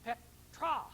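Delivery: "Petra" with the final "a" set clearly apart; ending stressed. Shown as a demonstration. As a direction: show the different ending of "Petra". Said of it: "it has a different ending".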